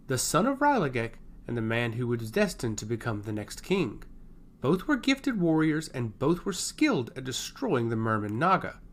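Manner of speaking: ordinary delivery, nothing marked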